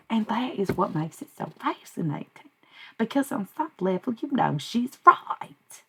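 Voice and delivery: channel a high, drawling Southern character; Leslie Jordan. Pretend. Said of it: "channeling a little Leslie Jordan".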